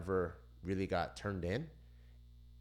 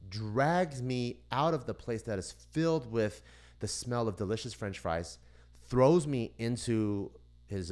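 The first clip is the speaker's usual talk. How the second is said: usual speaking voice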